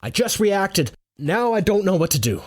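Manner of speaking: high-pitched, helpless protest; panicking. A narrator's reading. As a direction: explain yourself in a panic. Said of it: "I'm panicking would be an understatement".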